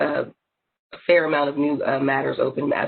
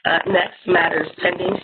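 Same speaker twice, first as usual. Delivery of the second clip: ordinary talk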